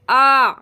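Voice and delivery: आ voiced loudly from the throat, with ain; hamza with ain. Shown as a demonstration. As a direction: push आ out strongly from the throat. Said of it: "हमज़ा को आइन के साथ जब मिलाते हैं तो पढ़ते हैं". (आ को आइन के साथ ज़ोर से)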